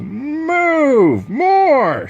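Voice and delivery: very high, loud yell of orders; coach shouting. Acted. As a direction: push them to run harder. Coach drives the team.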